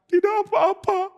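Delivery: put-on high, small voice of a hurt girl; sad girl Tamar. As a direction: speak like a hurt young girl hiding pain. (suara gadis sedih yang tinggi dan kecil)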